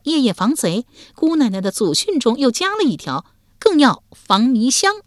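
lightly voiced proud young wife; a hint of a confident lady; young woman voice. A narrator's reading. Female character voice.

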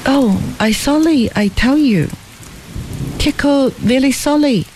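lightly voiced Tieko, contrite; sorry. A narrator's reading. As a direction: plead forgiveness as the lad. Tieko apologising.

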